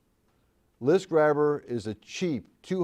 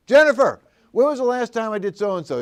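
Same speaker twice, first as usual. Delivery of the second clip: raised voice, calling across the office; 7 semitones higher, 5 dB louder than usual. Retold or imitated. Speaker calls out to assistant Jennifer in the office, loud call. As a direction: call her name loudly from the next room.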